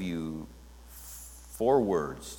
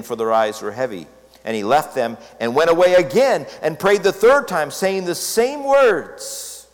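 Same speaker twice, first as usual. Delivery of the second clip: scripture read higher and louder, building; dramatic scripture reading; 6 semitones higher, 8 dB louder than usual. Acted. Preacher reading Matthew twenty-six to his church, building to fervent. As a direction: read Gethsemane with rising passion.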